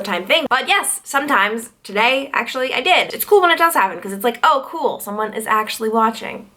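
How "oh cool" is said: usual speaking voice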